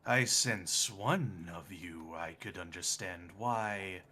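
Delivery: slow, low, quiet creepy voice; lightly voiced eerie presence. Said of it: "you hear it go".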